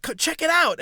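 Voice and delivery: high, loud, eager urging; excited imitation. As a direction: mimic an over-eager man calling drivers over. Acts out man urging a look.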